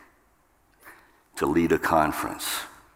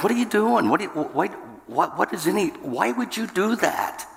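high-pitched, agitated stammering challenge; debater's tone; 13 semitones higher than usual. Retold or imitated. Husband debating his wife in an exchange, argumentative tone of voice. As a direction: fire off incredulous questions far above your usual pitch. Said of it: "that tone of voice".

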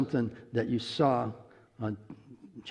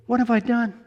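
mock panic; 11 semitones higher, 6 dB louder than usual. Panics after offering to pray.

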